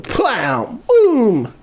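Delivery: drawn-out "plow" and high "boom"; imitated impact noises. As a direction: voice a cartoonish stab sound effect. Sound effects for the injection.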